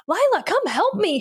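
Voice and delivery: high, mock-impatient call; impatient demand. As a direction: nag your sister to come help. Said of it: "being, like, impatient".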